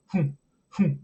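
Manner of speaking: short grunts like a game character jumping; two quick effort grunts for a jump. Shown as a demonstration. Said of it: "like, a jump sound".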